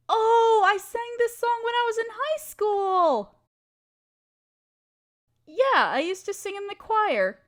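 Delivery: light up as the memory hits; excited. Acted. Remembering singing it in the high school choir, excited.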